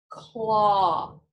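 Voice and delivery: "claw" said slow and loud with a long "aw"; modeling the word. Shown as a demonstration. Teacher modeling a word to repeat, drawn-out aw. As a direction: hold the "aw" in "claw" for the class. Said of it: "And claw".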